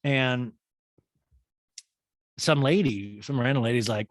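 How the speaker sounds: ordinary delivery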